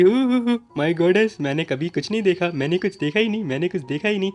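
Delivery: ऊँची आवाज़ में झेंपता, हकलाता नौकर; शर्म से हुहु करते हुए बात टालो; शर्माता हुआ Buttler. A narrator's reading. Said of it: "बेचारा शर्माता हुआ कह रहा होता है". (मालकिन के सामने झेंपकर ऊँची आवाज़ में सफ़ाई देता बटलर)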